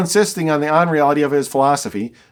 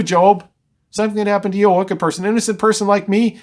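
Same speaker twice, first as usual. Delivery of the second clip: ordinary delivery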